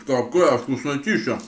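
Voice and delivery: chewing while talking; speaking around food; mouth full. Real, not acted. Seen 0: bite taken at -2s, still chewing while speaking to camera.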